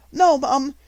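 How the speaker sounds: a frightened denial; protesting. A narrator's reading. Maid protesting to the headmistress, high and anxious. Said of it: "Becky protested".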